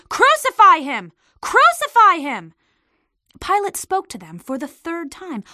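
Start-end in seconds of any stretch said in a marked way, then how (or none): 0.1-1.1 high, loud furious shout; shouting crowd. A narrator's reading. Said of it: "they kept shouting". 1.4-2.5 high, loud shout, repeated just as hard; shouting crowd. A narrator's reading. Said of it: "they kept shouting".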